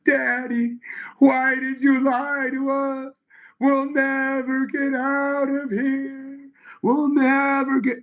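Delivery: put-on high, slow, pleading little girl; a small child begging her daddy; a girl in hell pleading with her father, high and slow. Little girl voiced in story.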